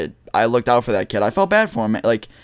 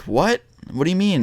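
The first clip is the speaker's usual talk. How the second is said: higher-pitched puzzled question; retold confusion; 7 semitones higher than usual. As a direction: imitate a confused kid, pitched up. Confused kid questions bully's insult.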